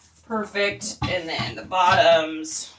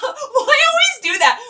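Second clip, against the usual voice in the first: very high, loud mock customer voice; buyer voice; 7 dB louder than usual. Seller answers a viewer's praise in the high, loud buyer voice. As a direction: squeal it like the fussy buyer. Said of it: "I love your buyer voice".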